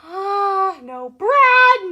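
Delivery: ordinary talk, nothing marked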